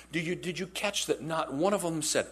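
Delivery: ordinary talk, nothing marked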